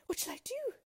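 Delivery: very high, desperate princess; despairing wail from a princess; panicked cry. A narrator's reading. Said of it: "she cried".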